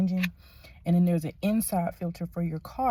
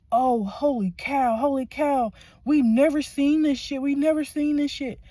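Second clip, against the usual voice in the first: loud, higher-pitched imitation of shocked mechanics; amazed outburst, retold at raised pitch and volume; retold astonishment; 8 semitones higher, 8 dB louder than usual. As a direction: exclaim it like stunned onlookers. Retold technicians' astonished reaction.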